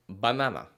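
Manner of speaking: "banana" with short open ah vowels; Spanish banana. Shown as a demonstration. Teacher modeling Spanish pronunciation, short clipped vowels. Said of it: "In some Spanish-speaking countries, you would say".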